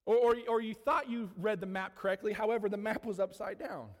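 usual speaking voice

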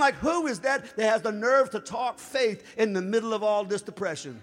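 swelling pulpit cry; preaching at full force. Acted. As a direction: build the fire toward the quote.

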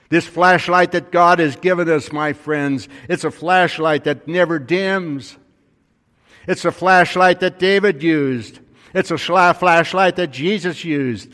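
a rising, fervent sermon close, each flashlight line stronger; preaching at full force. Acted. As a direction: ring it out like a pulpit finale. Preacher builds to climax.

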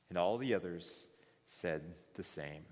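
normal talking voice

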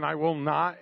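ordinary talk, nothing marked

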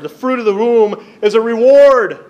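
loud proclamation. Real, not acted. Pastor declares the verse to his congregation, loud and high. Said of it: "I don't think we get that in our culture today".

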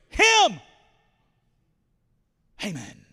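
sudden high, loud shout; accusing shout. Acted. Esther accusing Haman to the king, a sharp shout. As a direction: point and yell him.

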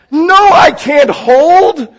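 high, drawn-out yell of refusal; exasperated shout. Acted. Impatient customer yelling at the drive-through speaker, exasperated. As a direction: explode at being told to wait, stretching the words.

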